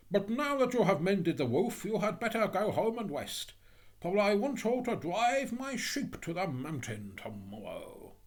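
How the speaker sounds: high, emphatic instructions; bossy, hearty miller. Acted. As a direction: hand out orders as kindly advice. Miller gives Hans orders.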